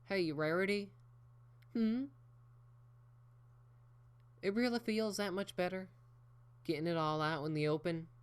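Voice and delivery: high country twang. Acted. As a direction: ask gently in a country twang. Applejack's voice.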